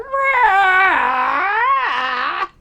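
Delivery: loud, high cackle of laughter; real laughter. Real, not acted. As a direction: burst into a long high laugh. Cracking up at the clip.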